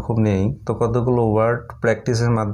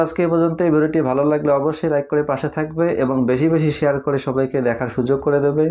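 normal talking voice

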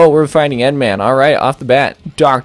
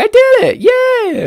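put-on high, shouted kid cheer voice; squeaky Tails cheer; 4 dB louder, slower than usual. Gamer voicing Tails cheering over the boss, squeaky and shouted. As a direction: cheer like a tiny kid who won. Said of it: "He's like".

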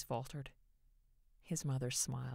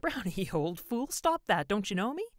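laughing scold; 7 semitones higher, 9 dB louder than usual. A narrator's reading. Laughing at barking dog.

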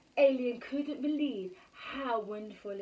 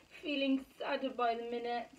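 ordinary delivery, nothing marked

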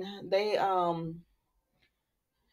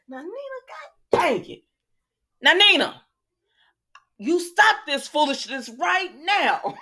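scolding Nina through the screen, much higher; 8 semitones higher, 4 dB louder than usual. Pretend. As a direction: tell her off like a fed-up friend. Scolding a soap character.

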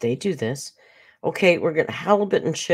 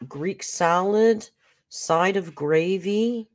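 1 slow savoring; 4 semitones higher, much slower than usual. Real, not acted. Savoring menu items.

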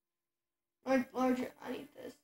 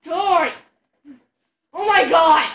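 loud furious yelling; berserk rage; 7 dB louder than usual. Real, not acted. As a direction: scream at the enemy. Yelling at enemies in game.

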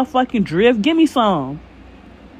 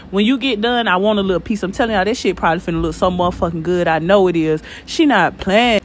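ordinary delivery

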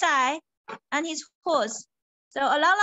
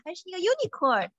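ordinary delivery, nothing marked

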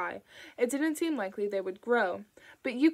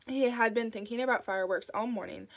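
usual speaking voice

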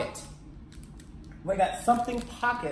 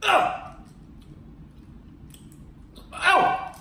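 shocked gasp; 10 semitones higher than usual. Real, not acted. Biting very sour candy.

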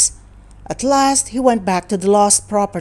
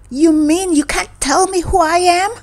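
indignant disbelief; 10 semitones higher, 4 dB louder than usual. Acted. Stranger indignant at Floyd.

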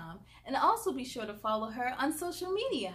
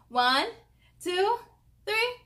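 rising count, higher on each number; countdown to goodnight; 9 semitones higher, 6 dB louder than usual. Real, not acted. Host counting down with the kids, rising excited goodnight. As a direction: build up to a cheerful good night.

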